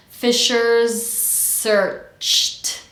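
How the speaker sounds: "Fishers searched" very slowly, buzz into hiss; Z into voiceless S. Shown as a demonstration. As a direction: stretch FISHERS SEARCHED, Z sliding into S. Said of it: "start search with a voiceless S".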